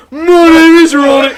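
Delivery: loud, high, stretched mock accent from Southampton; shouted Southampton mimicry. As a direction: holler it in broad Southampton, close to laughing. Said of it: "I would shout".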